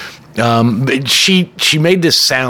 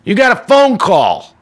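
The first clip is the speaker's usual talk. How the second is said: higher, calling across the room, slow on the last line; barking; 9 semitones higher, slower than usual. Retold or imitated. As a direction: bark his name across the diner. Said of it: "I hear Pete bark".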